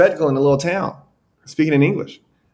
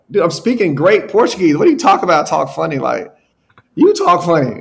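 higher, louder mock-offended comeback; indignant retort; 5 semitones higher, 5 dB louder than usual. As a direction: throw the insult right back, half joking. Retorting to butcher's teasing.

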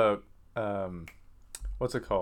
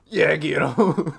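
louder, slow cheer through laughter; laughing mock referee urging a fighter on; cheering ringside; 4 semitones higher, 7 dB louder, much slower than usual. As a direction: stretch it out like a rowdy fan.

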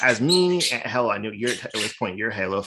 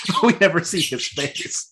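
higher-pitched, said through a laugh; 7 semitones higher than usual. Real, not acted. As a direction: let a laugh ride over the words. Laughs while making the point.

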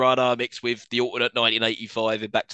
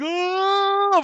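high thin wail drifting up; high floaty cry. Retold or imitated. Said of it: "why isn't she going".

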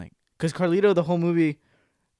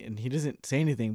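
ordinary talk

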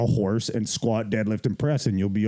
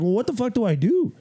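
much higher, pleading mock panic; exasperated self-quote; 13 semitones higher, 3 dB louder than usual. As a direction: squeak it out like a lost newbie. Retelling his own panicked reply.

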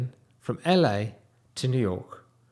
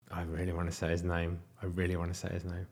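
low, soft, even mutter; restrained muttering; 7 semitones lower, 10 dB quieter, faster than usual. Real, not acted. Teller itching to name the laughing manager, restrained muttering. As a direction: mutter it low, as if holding yourself back.

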